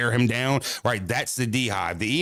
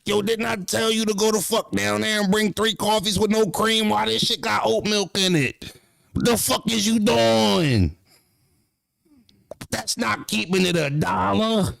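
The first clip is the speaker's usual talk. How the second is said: high-pitched mock boss chewing someone out; bossy rant; 10 semitones higher than usual. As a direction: scold like a fed-up boss over the coffee. Boss berating over coffee order.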